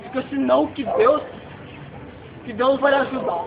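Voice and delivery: lightly voiced kind man comforting the mother; soothing client. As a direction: gently reassure her that God will help.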